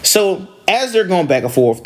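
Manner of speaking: normal talking voice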